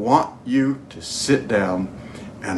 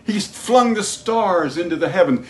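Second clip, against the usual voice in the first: high, ringing preacher's proclamation; full-force preaching; 10 semitones higher, slower than usual. Real, not acted. Preacher proclaiming God's miracles to the congregation, high impassioned preaching. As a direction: draw out the big words, pitched high.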